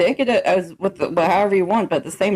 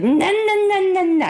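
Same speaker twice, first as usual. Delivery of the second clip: put-on boyfriend's frantic no; 11 semitones higher than usual. Boyfriend refusing to let her get lipo, in a high, frantic voice. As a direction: stop her fast, like the protective boyfriend. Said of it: "He's like".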